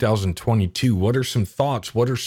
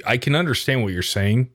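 usual speaking voice

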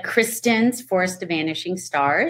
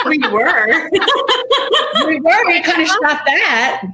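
giggling through the words; laughing; 10 semitones higher, 4 dB louder than usual. Real, not acted. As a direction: laugh while you say it. Laughing at the pun.

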